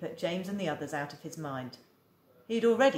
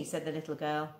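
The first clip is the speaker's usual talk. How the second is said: usual speaking voice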